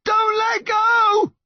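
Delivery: very high, drawn-out yell; desperate yell. A narrator's reading. Mum dangling from the cliff to her son, yelling. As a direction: plead at the top of your voice. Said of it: "his mum yelled".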